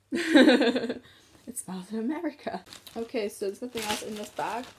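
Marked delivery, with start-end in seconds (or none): 0.1-2.6 laughing mock-incredulous echo; laughing disbelief. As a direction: burst out laughing, then repeat it amused. Retold laughing reply to friend.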